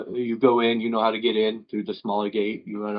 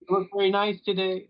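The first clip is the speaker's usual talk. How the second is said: high goofy compliment; 9 semitones higher than usual. Acted. GM continues the NPC voice.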